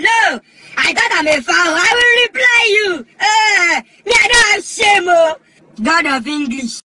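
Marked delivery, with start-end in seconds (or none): 1.6-5.4 high, loud angry shout; defiant outburst. Acted. Warning the person who insulted him, defiantly angry. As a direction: shout it out high with stretched words. Acted threat to answer back.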